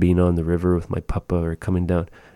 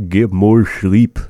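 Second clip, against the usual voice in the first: slow, loud mock advice; joking advice; 3 semitones higher, 7 dB louder, slower than usual. Teacher teasing sleepy learners, slow loud mock advice. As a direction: tease them to sleep more, slowly. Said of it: "No, just kidding".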